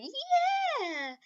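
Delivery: very high happy yell; excited cheer. Retold or imitated. Deku celebrating.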